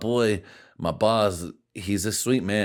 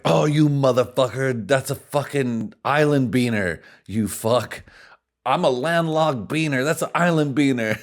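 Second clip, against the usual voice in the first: louder, higher mock outrage at the boss; mock outraged retort; 7 semitones higher, 6 dB louder than usual. Joking back at the boss who lumped groups together, in a louder, higher mock outrage. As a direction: play-act yelling at your boss in jest. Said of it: "And I was like".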